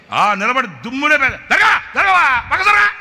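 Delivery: high loud yell to move; shouting move aside. Retold or imitated. Preacher yelling at the boy to step aside, high loud. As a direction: bark him out of the way.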